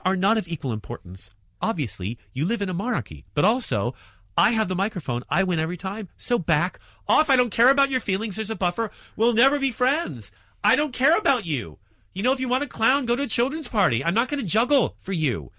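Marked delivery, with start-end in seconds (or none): 4.4-15.5 high-pitched, emphatic dismissal of an audience; comic's imperious rant from the stage; defiant put-down. Acted. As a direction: tell off a heckling crowd, pitch climbing. Mock telling-off of her audience.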